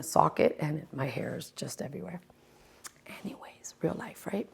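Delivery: hushed shrug; whispered aside. Real, not acted. To audio listeners about her hair, whispered aside.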